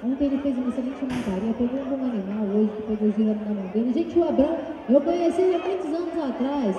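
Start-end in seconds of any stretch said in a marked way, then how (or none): 3.9-6.7 excited. Real, not acted. Excited cheer for rider.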